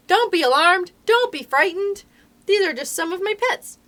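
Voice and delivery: high, loud shouted reassurance; shouting. A narrator's reading. Doctor reassuring the boy amid noisy animals, shouting. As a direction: shout it right into someone's ear over a din. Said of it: "and shout into my ear".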